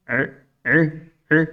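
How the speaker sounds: short separate "اے، اے، اے" for ain; lisping baby-talk sound. Shown as a demonstration. Teacher showing ain with a vowel, lisped "اے". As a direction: say "اے" childlike, one little burst at a time. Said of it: "توتلی زبان میں".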